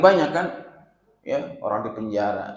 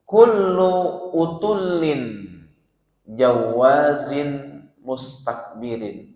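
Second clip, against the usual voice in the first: drawn-out chanted Arabic, very slow; slow reverent Arabic recitation; slow hadith recitation; 4 dB louder, much slower than usual. Acted. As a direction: intone the hadith slowly and solemnly.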